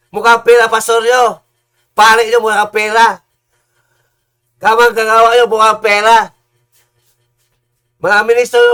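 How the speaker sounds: loud, hammering sermon denunciation; fiery preaching. Real, not acted. Preacher denouncing pastors as money-hungry, loud and fiery. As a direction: preach it hard at the false pastors.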